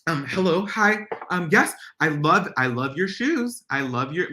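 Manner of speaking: butter up the boss nervously; sucking up. Retold or imitated. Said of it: "this weird thing that people do".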